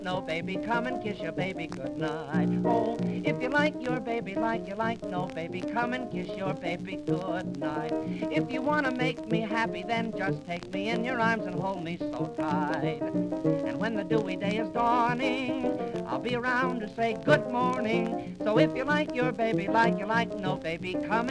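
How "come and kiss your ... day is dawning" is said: ordinary talk, nothing marked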